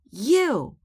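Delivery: high loud yell of "You". A narrator's reading. Matey yells back at Captain in their squabble, loud and high. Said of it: "yelled Matey".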